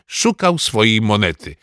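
ordinary delivery, nothing marked

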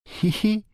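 very high, light giggle; short acted girlish titter; dziewczęcy chichot. A narrator's reading. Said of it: "roześmiała się Barbarka". (wysoki, krótki chichot)

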